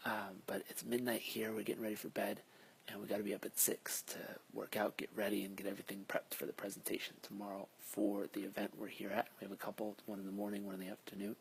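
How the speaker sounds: hushed late-night voice; quiet at night. Real, not acted. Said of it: "so I'm gonna whisper".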